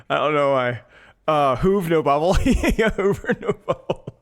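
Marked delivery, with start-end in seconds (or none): 1.3-4.1 laughing. Real, not acted. Repeating the joke, cracking up.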